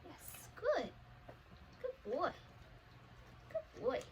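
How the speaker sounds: high, lilting praise for the dog. Real, not acted. Trainer praising her service dog in a high, lilting voice. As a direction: sing it up to a good dog.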